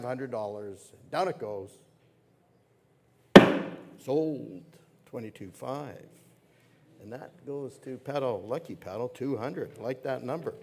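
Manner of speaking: brisk, lively auctioneer calling bids; fine art auctioneer. Acted. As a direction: keep the bids rolling like a gala auction.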